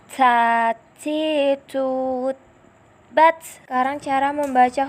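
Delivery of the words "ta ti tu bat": "Ta, ti, tu, bat" said clearly, with a loud bounced final t on "bat"; pronunciation demo. Shown as a demonstration. Teacher demonstrating the letter ta to students, crisp and bounced. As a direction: spell out "Ta, ti, tu" crisply, then hit "bat" with a sharp released t. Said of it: "Sekarang cara melafalkan huruf ta".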